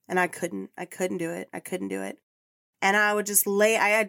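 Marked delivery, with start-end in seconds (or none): 0.1-2.2 choked up. Real, not acted. Grieving owner recalls refusing euthanasia.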